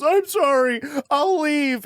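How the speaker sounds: high-pitched imitated tearful apology; mock weeping. Weeping audience woman apologizing to the comic, mock tearful. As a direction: wail it as a hammed-up crying woman. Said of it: "started loudly weeping".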